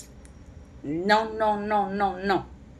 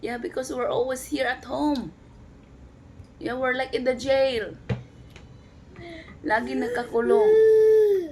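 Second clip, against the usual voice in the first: high, whimpering fake cry for the baby; mock whiny crying; 7 semitones higher, 5 dB louder than usual. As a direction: whine it like a fussy toddler about to bawl. Voicing the baby's complaint.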